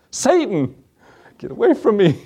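high, comic cry at the devil; hammed-up, high-pitched banishing; mock rebuke. Man startled by a barking crow, mock-rebuking Satan.

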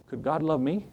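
soft, wondering question; imitating a humbled man, voice dropped; hushed disbelief. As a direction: ask it quietly, as a doubting sinner. Quotes a doubting condemned killer.

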